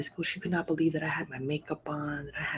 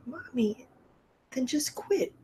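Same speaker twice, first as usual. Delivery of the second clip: put-on child voice; 9 semitones higher, slower than usual. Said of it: "She's like".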